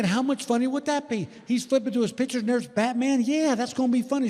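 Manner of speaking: high-pitched, hammed-up eagerness; giddy excitement. Husband sells his prank to wife, giddy and high.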